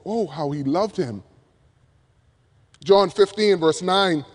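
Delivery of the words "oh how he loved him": Oh, how he loved him read softer and lower, almost to oneself; hushed tender reading. Acted. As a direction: let the wonder settle quietly. Verse re-read softly.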